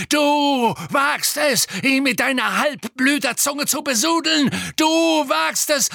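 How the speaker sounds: very high, loud screeching tirade; outraged shriek. A narrator's reading. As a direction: keep screeching the accusation at full force. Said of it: "kreischte Bellatrix".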